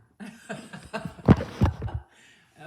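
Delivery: loud burst of laughter; amused laugh. Real, not acted. Amused by bunny climbing.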